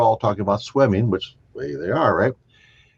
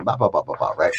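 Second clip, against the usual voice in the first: ordinary delivery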